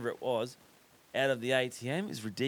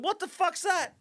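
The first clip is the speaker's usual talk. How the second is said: very high, puzzled squeak; baffled at the driver's offer; mock bewildered; 4 dB louder than usual. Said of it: "I said".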